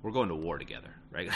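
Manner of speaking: usual speaking voice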